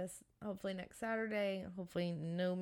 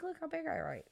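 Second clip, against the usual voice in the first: high-pitched mock defense; 10 semitones higher than usual. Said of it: "I'm like".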